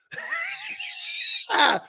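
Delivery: long, high cackling laugh; real laugh. Real, not acted. Host cracking up at the special education joke, real high laugh. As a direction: burst out in high, helpless laughter.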